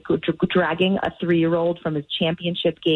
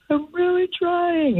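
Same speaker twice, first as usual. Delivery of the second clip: imitating a whiny little boy; 14 semitones higher than usual. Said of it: "He's like".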